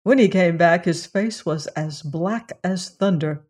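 plain reading voice

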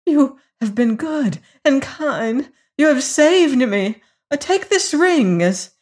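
high, plaintive pleading; whimpering gratitude. A narrator's reading. Foolish lady pressing a ring on her rescuer, high whimper. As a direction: gush thanks in a whimpering high voice. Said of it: "whimpered the foolish little woman".

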